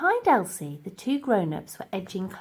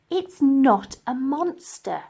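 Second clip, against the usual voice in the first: put-on slow, firm girl's reply; Elsie firmly; 5 semitones higher, 6 dB louder, slower than usual. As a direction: correct him firmly. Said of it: "replied Elsie".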